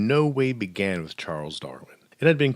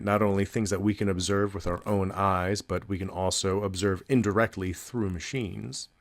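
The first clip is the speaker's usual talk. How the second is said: normal talking voice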